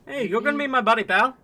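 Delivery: put-on high, lively Canadian accent. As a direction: play him as a broad Canadian. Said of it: "can't wait for the accent".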